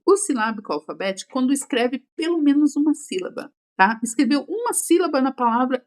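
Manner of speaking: ordinary talk, nothing marked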